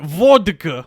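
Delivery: put-on Russian accent, drawn out; mock Russian, syllable by syllable. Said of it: "Let me see if I can get into it".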